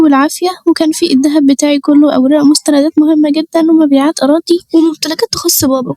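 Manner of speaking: high-pitched, distressed wail of a voice; tearful panic. A narrator's reading. Robbed young woman confides in her cousin, tearful and panicked. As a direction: plead in a high, frightened whimper. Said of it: "اهدي يا نورهان وما تعيطيش".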